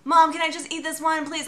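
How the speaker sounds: put-on kids begging mom for candy, mildly whiny; the kids asking mom to eat candy, mock pleading. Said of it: "the whole night they were like".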